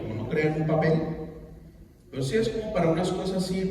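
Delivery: ordinary talk, nothing marked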